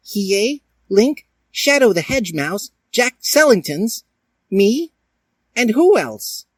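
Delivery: very high, squeaky cartoon voice; squeaky Edward voice. Edward counting his gang as the new teacher, in a very high squeaky voice. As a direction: chirp like an excited cartoon character.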